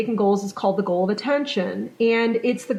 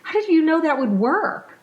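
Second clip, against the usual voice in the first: amazed mom; 6 semitones higher than usual. Retold or imitated. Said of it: "And she goes".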